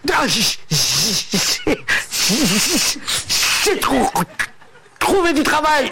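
slow, stuck on each word; stammering. Acted. Stuttering man telling his friend he found work, stammering. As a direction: stutter with long stops between words. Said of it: "C'est un mec qui bégaye".

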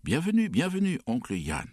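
high, bouncing welcome chant; excited welcome. A narrator's reading. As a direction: cheer him in like jumping kids. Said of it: "nous répétâmes tous en sautant sur place". (accueil enjoué et aigu)

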